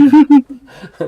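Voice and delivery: bursting out laughing, high and loud; a big real laugh at the haunted burger shop. Real, not acted. GM laughs at the haunted burger shop, a big loud laugh.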